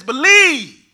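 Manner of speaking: belted out, loud and high; a preacher's loud cry of the word; loud command. Acted. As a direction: proclaim it with full force. Loud then hushed repeat.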